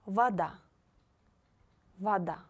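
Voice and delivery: Вода said vada, first о as а; voda with а. Shown as a demonstration. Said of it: "you should read like А".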